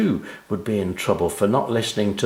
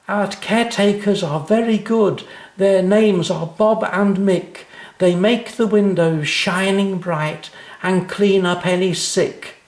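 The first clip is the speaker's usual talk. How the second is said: a schoolgirl proudly reciting her poem; little girl reciting; 11 semitones higher, 3 dB louder than usual. A narrator's reading. Anna keeps reciting her poem.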